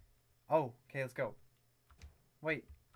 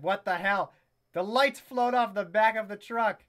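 usual speaking voice

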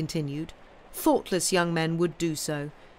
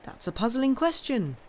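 cool reply; 7 semitones higher than usual. A narrator's reading. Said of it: "coolly rejoined Sam".

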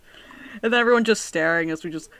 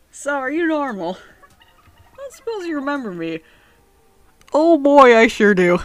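high voice building to a big loud reply; hammed-up NPC exchange; 8 semitones higher, 12 dB louder than usual. Gamer voicing the Dark Lord's reunion. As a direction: ham up the cheery answer.

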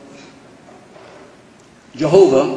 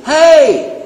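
high, loud hail; calling out; 12 semitones higher, 8 dB louder than usual. Shown as a demonstration. Preacher showing how you call upon somebody, calling out. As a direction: holler to get someone's attention. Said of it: "How do you call upon somebody?".

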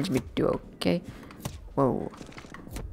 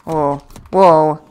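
normal talking voice

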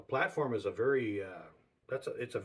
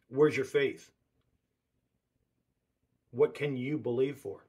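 challenging call; 6 dB louder than usual. Real, not acted. Preacher challenging doubters.

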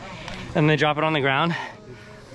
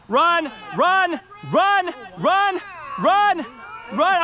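shouting; 13 semitones higher, much slower than usual. Real, not acted. Yelling at a runner.

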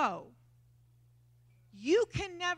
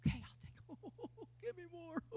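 soft tearful receiving; 4 semitones higher, 12 dB quieter, faster than usual. Retold or imitated. Quietly receiving God's mercy, crying.

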